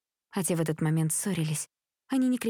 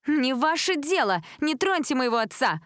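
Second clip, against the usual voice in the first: high, indignant cry; defensive shout; 7 semitones higher than usual. A narrator's reading. Lila defending her father from accusers, shouting. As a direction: cry out in a raised pitch, fending them off. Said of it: "закричала Лила".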